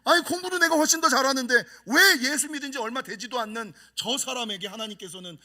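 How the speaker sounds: usual speaking voice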